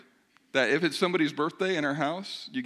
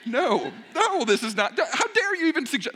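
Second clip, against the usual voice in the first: mock indignation; 10 semitones higher, 5 dB louder than usual. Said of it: "no, I'm just kidding".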